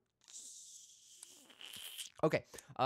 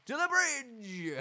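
drawn-out mock call; 8 semitones higher, slower than usual.

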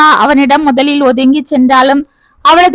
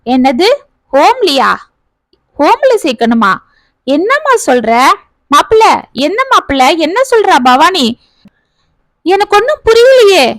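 higher, rising disbelief from the brother; bewildered elder brother; 4 semitones higher than usual. Acted. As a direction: brother baffled and pleading for answers.

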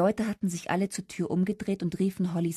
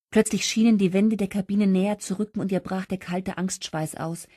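usual speaking voice

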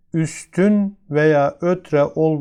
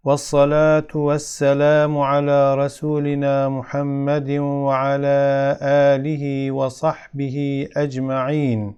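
Arabic opening recitation; much faster than usual. Real, not acted. Opens with Arabic prayer formula.

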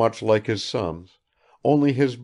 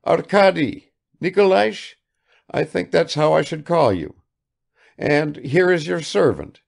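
put-on eager old host; 9 semitones higher, 5 dB louder than usual. Vassily addressing Arkady.

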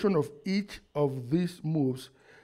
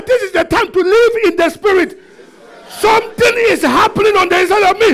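fast, high-pitched preaching shout; rapid, impassioned pulpit yell; say it like you mean it; 11 dB louder, faster than usual. Shown as a demonstration. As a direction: shout it out with total conviction. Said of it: "You are not saying as if you mean it".

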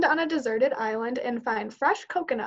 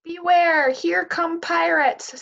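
loud, drawn-out call to the crew; pirate yell; 6 semitones higher, 5 dB louder, slower than usual. Acted. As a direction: bellow it like a pirate captain. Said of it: "Sam yells".

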